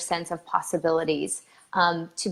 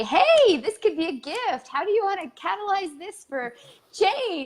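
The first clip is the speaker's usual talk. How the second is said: bright, much higher sing-song pep talk; mock cheerful positivity; 7 semitones higher than usual. Cheery voice pushing the sufferer to see a gift. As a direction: chirp it like a relentless optimist. Mocking a pushy positive helper.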